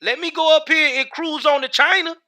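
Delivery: high, mocking take on someone planning a trip; mock them in a high voice; mocking migrant voice. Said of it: "They didn't say".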